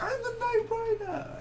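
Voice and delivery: high, drawn-out movie villain cry; Knight Rider quote. Retold or imitated. Reviewer imitating the Knight Rider's line, high drawn-out cry. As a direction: stretch it out high like a crazed villain.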